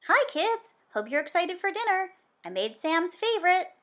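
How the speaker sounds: dad coming out of the kitchen, beaming; cheerful dad. Acted. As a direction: boom a happy welcome to the kids. Dad greets kids cheerfully.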